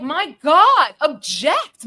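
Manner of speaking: sudden loud outburst, pitch jumping up; mock objection. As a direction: shout it like a courtroom objection. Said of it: "and I'm like".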